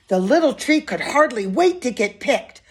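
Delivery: ordinary delivery, nothing marked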